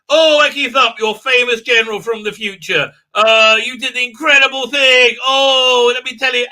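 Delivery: loud, high hammed-up fan worship; exaggerated loud hero-worship; mock gushing. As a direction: send up a starstruck fan. Mocking gushing praise of the general.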